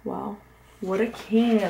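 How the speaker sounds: ordinary delivery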